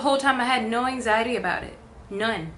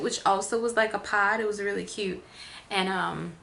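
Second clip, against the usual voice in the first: ordinary talk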